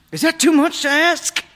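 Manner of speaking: very high, loud, anguished yell; exasperated wail at full voice; frustrated outburst. A narrator's reading. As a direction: shout it like a kid at breaking point. Frustrated son's outburst in story.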